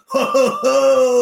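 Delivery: high, loud sung "ho ho ho". Retold or imitated. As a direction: sing it out high like the song's opening. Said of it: "you'll hear the opening little".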